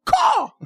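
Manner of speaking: single very high "caw"; bird-call catchphrase. Shown as a demonstration. Radio host repeating a signature call, high squawk. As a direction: squawk it once, high like a crow. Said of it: "That is my call".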